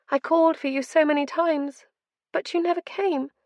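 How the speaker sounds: high, hurt and accusing; tearful reproach. A narrator's reading. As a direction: let the hurt pour out. Mother reproaching through tears.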